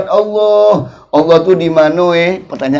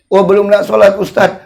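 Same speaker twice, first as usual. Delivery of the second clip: usual speaking voice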